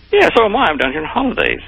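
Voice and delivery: high-pitched voicing of an older tourist; friendly tourist. American tourist greeting a fellow countryman abroad, high-pitched imitation. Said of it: "he was a tourist down there".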